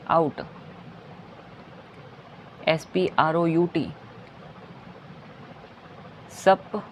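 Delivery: ordinary talk, nothing marked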